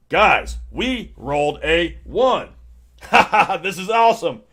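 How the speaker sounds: slow proud announcement into a laugh; mock triumphant [name]. [name] announcing his response to everyone, proud and laughing. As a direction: crow it proudly then laugh.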